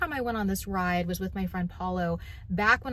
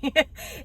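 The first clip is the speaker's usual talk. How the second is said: light laugh; 5 semitones higher than usual. Real, not acted. Laughing at her own story.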